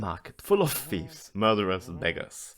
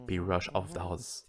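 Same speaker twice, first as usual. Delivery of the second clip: usual speaking voice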